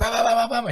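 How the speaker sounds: high mock knocking sounds; imitated banging. Kid remembering dad pounding on the door, mock banging. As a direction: bang out the knocks with your voice.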